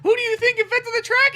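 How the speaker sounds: high, loud smug squeak; smug frog voice. Pretend. Frogs bragging about intelligence, high loud squeak. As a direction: boast in a high cartoon voice.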